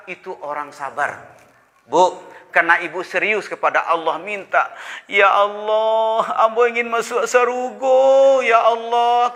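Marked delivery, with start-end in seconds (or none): 5.1-9.3 sing-song pleading, pitched higher; pleading prayer. Acted. The wife begging God for heaven, sing-song plea. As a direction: cry out to God, almost singing.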